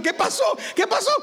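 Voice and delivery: pregunta muy aguda y alarmada; pánico imitado. Retold or imitated. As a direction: repetir con susto fingido, subiendo el tono. Retold panic after screaming. (alarma cómica, voz aguda)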